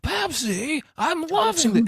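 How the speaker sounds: a dazed viewer suddenly sold on the brand; mock surprise. Viewer hearing the Pepsi plug, squeaky mock delight. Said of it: "and you're like".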